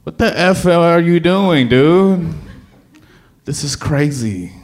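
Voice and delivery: high, loud self-doubt; incredulous. Retold or imitated. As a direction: exclaim it in disbelief. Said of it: "I said to myself".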